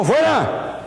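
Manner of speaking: high-pitched, angry order to get out; acted burst of righteous anger; imitated angry command. Preacher voices Jesus driving merchants out with a whip, angry. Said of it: "cuando está enojado y dijo".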